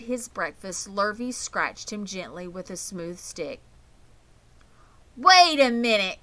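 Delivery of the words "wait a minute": put-on loud, eager boy's call; excited cry. A narrator's reading. Avery calls the family over to the tag. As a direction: stop everyone, something's found. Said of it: "cried Avery".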